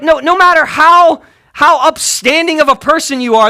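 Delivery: ordinary talk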